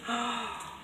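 quick gasp of surprise; surprised gasp. Real, not acted. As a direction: catch your breath at the find. Gasp at new stamps.

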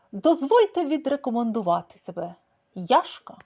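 loud, grandly formal alley cat; pompous tomcat. A narrator's reading. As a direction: introduce himself with swagger. Кіт пафосно представляється. (пишномовне представлення кота)

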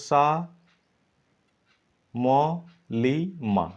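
সা, ম, লি, মা as separate slow beats; syllable by syllable. Shown as a demonstration. Teacher splitting সামলিমা for the class. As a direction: break সামলিমা into four parts. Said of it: "সামলিমাকে তোমরা ভাঙো".

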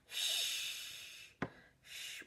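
whispered "Shh" held out; S H sound. Shown as a demonstration. Teacher demonstrating the SH ending of "fish". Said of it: "It ends with an S H sound".

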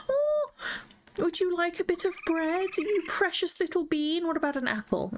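high, cooing voice to a pet; tender pet-talk, voice lifted high. Real, not acted. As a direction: coo at the little animal. Baby talk to a raccoon.

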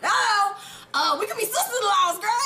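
high, loud flirty hello; flirty message. Pretend. A message to the stepdad's single relatives, high and loud flirting. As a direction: call out sweet and flirty. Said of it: "So tell him I said".

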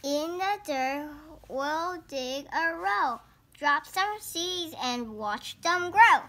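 slow sing-song storybook read-aloud; picture book reading. Acted. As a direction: read each page like a picture book for little kids.

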